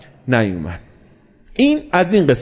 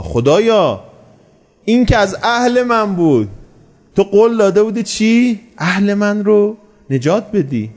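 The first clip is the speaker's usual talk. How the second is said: higher-pitched pleading, only lightly raised; mild retold cry; 7 semitones higher than usual. Noah appeals to God for his son, mild retold cry. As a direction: imitate a father's appeal without shouting. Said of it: "فریاد زد، خطاب به خدا".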